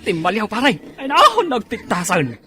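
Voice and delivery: high, loud, excited outburst; excited ogling. Acted. (naragsak unay ken napigsa)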